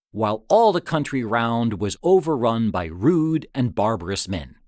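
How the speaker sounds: ordinary delivery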